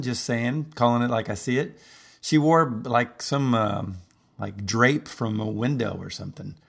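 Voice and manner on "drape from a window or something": ordinary talk, nothing marked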